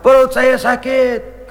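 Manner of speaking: drawn-out comic complaint; mock groan. Overfed man tells Umar his stomach hurts, mock groaning.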